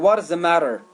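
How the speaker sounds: "matter" said like "madder"; American "matter", tapped middle and R ending; American flapped T. Shown as a demonstration. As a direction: turn the double T of "matter" into a quick D.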